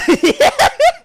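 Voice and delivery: real shrieking laugh. Real, not acted. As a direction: crack up loudly. Laughing at own accent.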